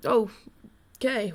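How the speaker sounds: ordinary talk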